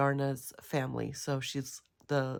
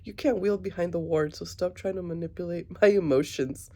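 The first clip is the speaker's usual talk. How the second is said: mock retort; 6 dB louder than usual. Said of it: "She says".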